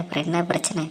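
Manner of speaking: ordinary delivery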